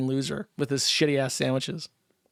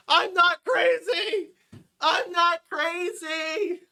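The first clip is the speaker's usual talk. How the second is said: mock sobbing; 13 semitones higher, much slower than usual. Mock crying falsetto.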